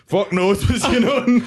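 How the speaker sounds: high, laughing mock bewilderment; slow, giggly retelling in a high voice; retold laughing exclamation. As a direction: quote it pitched right up, cracking up. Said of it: "like that".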